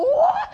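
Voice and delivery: very high, loud, laughing "What?"; dumb sheep bleat-like what. Retold or imitated. Friend mimics sheep at the barn door, laughing squeaky what. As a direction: play a baffled sheep, squeaky and silly. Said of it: "come right to the door and go".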